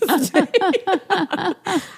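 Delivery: drawn-out rising laugh; high sustained laughter; long high laugh. Real, not acted. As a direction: keep laughing. Continued laughter.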